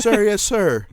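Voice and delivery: high, loud mock recruit reply; recruit shout. Imitating recruits answering their instructor, loud high shout. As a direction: shout it back like a drilled recruit.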